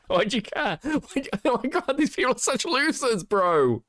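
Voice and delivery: high, laughing disbelief; laughing incredulous. Real, not acted. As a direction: laugh through the words in disbelief. Mocking laughing rant.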